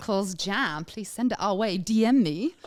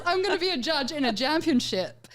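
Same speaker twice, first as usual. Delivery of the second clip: higher, louder, laughing through the words; laughing with delight; 7 semitones higher, 6 dB louder than usual. Real, not acted. Host telling co-hosts he'll judge jam, laughing. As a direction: giggle it out in disbelief. Thrilled about judging jam contest.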